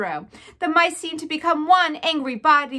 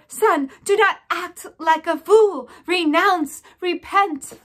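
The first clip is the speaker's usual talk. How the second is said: slow, louder, higher urgent plea; mother mouse shouting at her son; 5 semitones higher, 6 dB louder, slower than usual. A narrator's reading. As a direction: beg him to give in. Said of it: "shouted his mother".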